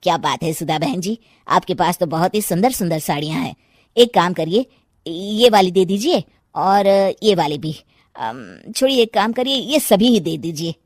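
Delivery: a keen shopper gushing over the saris; customer Birju. Acted.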